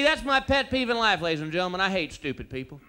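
usual speaking voice

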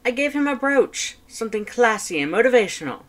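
high, loud, indignant skeleton; Papyrus, near outrage. Acted. Papyrus defending his gift to his brother, high indignant. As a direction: protest loudly, pitch shooting up. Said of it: "intonation encroaching on outrage".

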